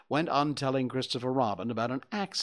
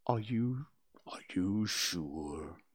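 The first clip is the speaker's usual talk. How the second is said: gloomy Eeyore; 5 semitones lower, 6 dB quieter, slower than usual. Acted.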